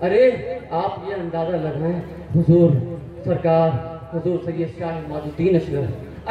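normal talking voice

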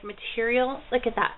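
usual speaking voice

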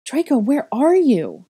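high, loud, slow calling out; desperate call. A narrator's reading. Searching the night for the boy, high slow call. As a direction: stretch it out across the field.

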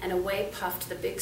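usual speaking voice

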